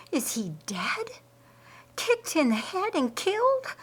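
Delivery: shocked, breathless dismay, dropping to a whisper; a woman sees a man kicked by a horse; horrified. Acted. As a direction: gasp it out in horror, high and shaken. Martha horrified by horse attack.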